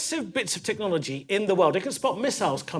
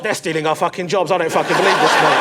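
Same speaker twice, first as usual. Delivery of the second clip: mock outrage; 7 dB louder than usual. Acted outrage in taxi.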